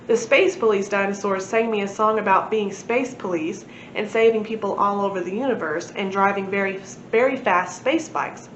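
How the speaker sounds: usual speaking voice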